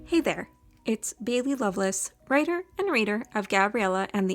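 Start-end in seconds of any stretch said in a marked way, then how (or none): none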